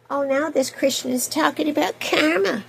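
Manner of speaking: sarcastic imitation of a scoffing critic; mocking critic. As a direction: parody a gotcha critic. Imitating critics of her word choice.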